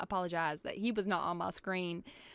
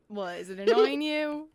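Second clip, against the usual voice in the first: louder, higher retold taunt; 4 semitones higher, 8 dB louder than usual. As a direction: throw it back provocatively. Retelling her taunting reply.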